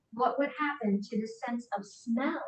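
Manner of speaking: ordinary talk, nothing marked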